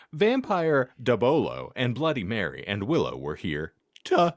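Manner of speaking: normal talking voice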